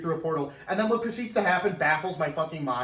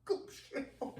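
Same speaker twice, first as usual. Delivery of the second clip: Glupshido breathed as a whispered, higher sob; mock sobbing whisper; 8 semitones higher, 15 dB quieter, slower than usual. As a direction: whisper Glupshido like a broken mourner. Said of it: "they go on like that".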